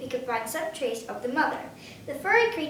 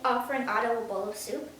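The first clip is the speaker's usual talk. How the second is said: plain reading voice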